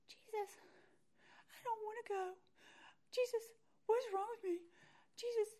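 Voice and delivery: high, small whimper between little pauses; pleading mock whimper, broken into short bursts; imitated whimpering. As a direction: whimper a helpless prayer in a high voice. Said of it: "doing that little whimper kind of thing".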